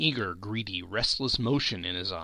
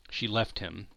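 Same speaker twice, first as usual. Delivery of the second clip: ordinary delivery, nothing marked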